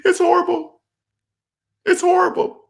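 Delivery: very high squeak after laughing; real laughter-squeezed high voice; helpless amusement. Real, not acted. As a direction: squeal it out, tickled by your own joke. Cracked up by his own demo.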